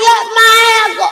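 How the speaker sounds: high loud yelp; pained shout. Retold or imitated. Mother yells as daughter pulls her hair, high loud shout. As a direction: yell as your hair is pulled. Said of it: "I'm like".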